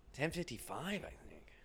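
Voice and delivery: whispered aside. Real, not acted. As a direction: whisper the time to yourself. Unvoiced aside about time.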